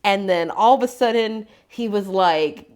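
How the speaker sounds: ordinary talk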